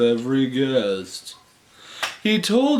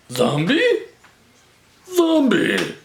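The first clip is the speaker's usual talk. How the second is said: "Zombie" rising into a high squeak, louder; silly squeaky voice; 4 dB louder than usual. Shown as a demonstration. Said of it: "silly voice play".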